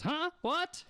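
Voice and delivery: put-on high-pitched, confused old man; high, bewildered "who's there" voice; startled old man. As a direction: react as a puzzled old fellow. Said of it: "turns to his right and goes".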